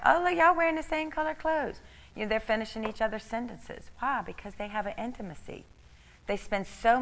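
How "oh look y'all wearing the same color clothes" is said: much higher, teasing voice; mock surprised delight; playful exclamation. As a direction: tease the matching couple. Mimicking her own greeting to couples.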